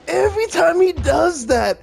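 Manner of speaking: high, drawn-out frightened groan; startled dread. Real, not acted. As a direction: recoil in real fear. Alien startles him in game.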